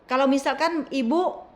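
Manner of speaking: high, loud pleading through a door; loud calling. Retold or imitated. Therapist calling to her through the bedroom door, loud and high. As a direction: shout to be heard through the door. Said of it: "Ngomongnya keras begitu".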